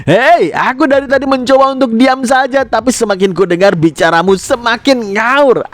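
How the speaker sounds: indignant high-pitched outburst; acted anger, voice pitched well up; mengamuk. A narrator's reading. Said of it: "tiba-tiba ngamuk dan dia bilang". (mengamuk dengan nada tinggi)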